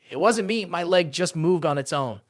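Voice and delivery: plain reading voice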